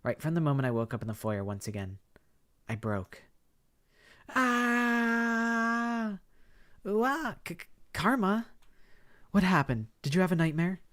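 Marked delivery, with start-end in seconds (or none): 4.3-7.4 sharp high frightened gasps; gasping awake. Acted. Waking from a nightmare. 7.4-10.8 put-on high, stammering girl's voice, concerned; a startled friend checking on her; worried friend.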